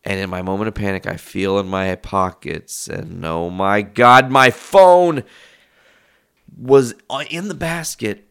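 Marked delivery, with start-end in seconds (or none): 3.2-8.2 louder and much higher, dragged out slowly; the penny drops, almost shouting it; excited realization. Retold or imitated. Recalling the phone in the pocket, loud slow dawning realization.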